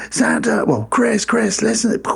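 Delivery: high-pitched imitation of a panicked caller; mock alarm down the phone; urgent phone caller.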